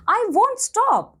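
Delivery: high, drawn-out refusal; storybook puppet's high, slow protest; mild defiant cry. A narrator's reading. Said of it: "cried Pinocchio".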